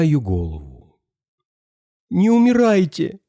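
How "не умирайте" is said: high, pleading cry without real sobs; acted crying entreaty; tearful plea. A narrator's reading. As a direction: beg in a high child's voice, close to tears. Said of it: "заплакала она".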